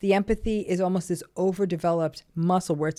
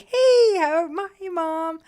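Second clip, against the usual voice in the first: much higher and louder, bright fake cheer to mom; child faking cheer at the door to greet a volatile mother; forced cheerful greeting; 10 semitones higher, 8 dB louder, slower than usual. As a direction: put on a sunny act so mom won't attack. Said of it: "you make sure you come in cheerful".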